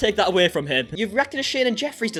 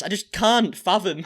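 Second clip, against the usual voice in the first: usual speaking voice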